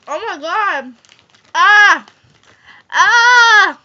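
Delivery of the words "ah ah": loud rising yells of pain. Real, not acted. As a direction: yell out against the burn. Burning mouth.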